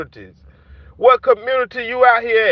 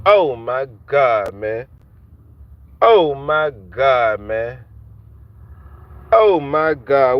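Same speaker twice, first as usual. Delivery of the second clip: lower, slow, weary disbelief; lower, slow exasperated sigh; exasperated groan; 8 semitones lower, slower than usual. Real, not acted. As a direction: drag it out like you're fed up.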